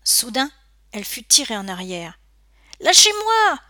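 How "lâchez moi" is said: high, loud yell while struggling; high-pitched outraged scream; screamed protest. A narrator's reading. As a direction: shout it out sharply, fighting to get free. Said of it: "hurla-t-elle en se débattant".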